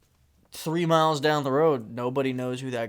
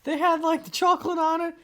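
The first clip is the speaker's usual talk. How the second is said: kid voice impression; 5 dB louder than usual. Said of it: "The guy's like".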